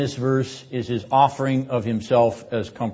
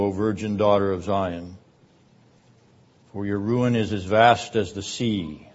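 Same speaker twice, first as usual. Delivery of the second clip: solemn, measured Bible reading; scripture reading. Acted. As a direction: read the verse as sacred text. Reading scripture passage aloud.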